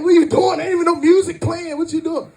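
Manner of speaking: loud, high baffled outburst; exasperated dad. Retold or imitated. Dad questions fidgety son without music, loud and incredulous. Father calls out his dancing son.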